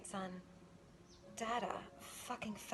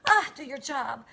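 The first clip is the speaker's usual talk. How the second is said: barking much louder, higher; mock angry shout; 4 semitones higher, 16 dB louder than usual. Daddy scolding the feds, a loud angry shout. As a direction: yell it like a fed-up boss. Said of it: "he's like".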